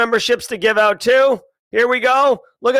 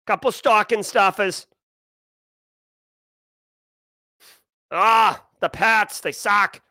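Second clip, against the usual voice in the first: put-on Boston accent. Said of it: "my actual Boston accent".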